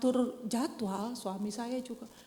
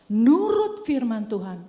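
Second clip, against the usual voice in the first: slow, loud preaching punch; loud emphasis; 10 dB louder, slower than usual. Acted. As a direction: hammer the words home. Preacher punches a key phrase. (ditekankan keras dan lambat)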